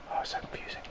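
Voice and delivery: whispered aside. Real, not acted. Gamer lost in the game, whispering to himself. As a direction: breathe it as a hushed aside.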